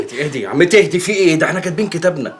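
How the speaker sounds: high, loud pleading; calm her down in a rush; a loud, high-pitched plea to calm the aunt; frantic calming. Acted.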